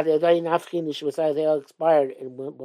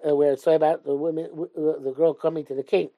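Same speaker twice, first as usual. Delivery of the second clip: usual speaking voice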